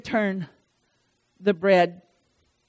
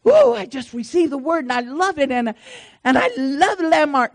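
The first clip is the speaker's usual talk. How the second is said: excited, higher-pitched gush; 4 semitones higher than usual. Retold or imitated. Preacher acting out a woman thrilled by the word, gushing. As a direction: imitate an enthusiastic new believer.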